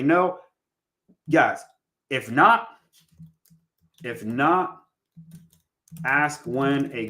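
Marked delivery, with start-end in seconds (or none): none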